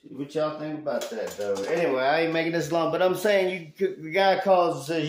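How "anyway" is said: ordinary delivery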